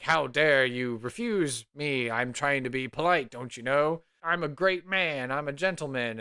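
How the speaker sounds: loud, affronted pompous mayor; getting angry. Acted. Rebuffed mayor scolding a maiden, getting angry. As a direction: puff up in acted indignation. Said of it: "so he starts getting angry".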